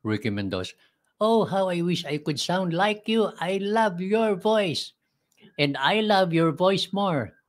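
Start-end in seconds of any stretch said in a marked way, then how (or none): 1.2-7.4 high, drawn-out impression; Cadoroy Valencia. Admiring each other's voices, high slow mimicry. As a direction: put on a much higher voice, stretching the words. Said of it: "I can mimic the voice of Cadoroy Valencia".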